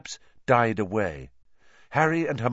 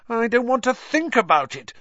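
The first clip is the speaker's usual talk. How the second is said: moaning; 13 semitones higher, 4 dB louder than usual. A narrator's reading. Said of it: "Hermione moaned".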